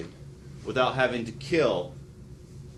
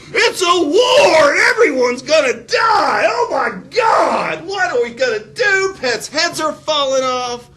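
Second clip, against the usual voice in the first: hammed-up panicked screaming, much higher and louder; panicked shouting; 11 dB louder, slower than usual. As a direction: shriek it like the world is ending. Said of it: "No, they said".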